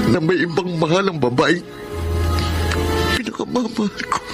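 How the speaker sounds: heartbroken, voice climbing toward tears; crying as he admits his love; tearful confession. Acted. Wag po kayong umiyak.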